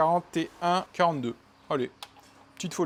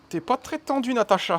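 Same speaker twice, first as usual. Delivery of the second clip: teasing voice; 8 semitones higher than usual. Pretend. Talks to part as Natacha.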